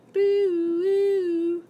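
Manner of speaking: high, loud "boo-woo" siren; playful imitation siren; mock siren wail. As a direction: imitate an alarm with long held hoots. Mock alarm after stop, drop, roll.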